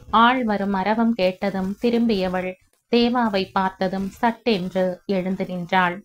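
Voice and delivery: usual speaking voice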